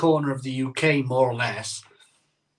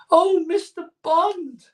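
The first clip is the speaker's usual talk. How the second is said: put-on swooning Bond girl; 13 semitones higher, 5 dB louder, slower than usual.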